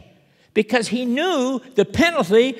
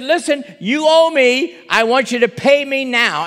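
loud, hard demand for payment; angry demand; 3 semitones higher, 7 dB louder than usual. Acted. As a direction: grab him by the throat and demand it. Servant seizes fellow by neck.

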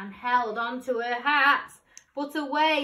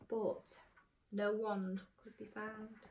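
ordinary delivery, nothing marked

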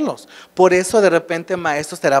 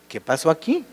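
soft, quietly baffled "what happened"; puzzled aside; 5 dB quieter than usual. Acted. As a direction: mutter it to yourself, a touch confused. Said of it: "Y es como de".

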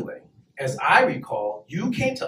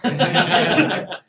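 exasperated curse; 10 semitones higher, 7 dB louder, slower than usual. Retold or imitated. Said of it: "It's like".

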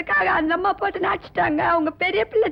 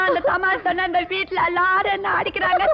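normal talking voice

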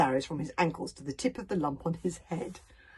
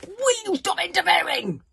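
high, loud, raging shout; furious shout; 13 semitones higher, 11 dB louder than usual. A narrator's reading. Fishlegs shouts at Hiccup in a rage. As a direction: shake him off in a rage.

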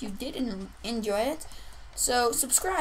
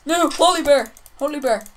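urgent shouting; 3 semitones higher, 7 dB louder than usual. Pretend. Calling out in a game panic.

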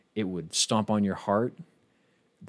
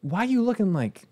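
startled squeal; 14 semitones higher, 7 dB louder than usual. Real, not acted. Startled by Pokemon's look.

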